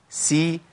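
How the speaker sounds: سي with a bright i; a short clear سي; sin with kasra. Shown as a demonstration. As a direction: spread the lips for سي. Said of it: "تحريك السين بالكسر".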